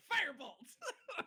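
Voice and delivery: high, loud, drawn-out laughing cry; mock spell cry. Game master joking about next session's opening, laughing mock battle cry. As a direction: call out the spell way up high, cracking up. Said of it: "be like".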